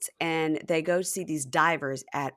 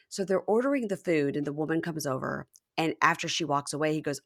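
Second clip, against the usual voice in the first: ordinary talk, nothing marked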